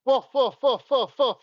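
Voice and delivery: high yappy woofs; dog barking. Shown as a demonstration. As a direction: bark like a small dog. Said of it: "Ken wants to hear me bark".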